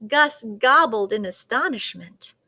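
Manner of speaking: ordinary delivery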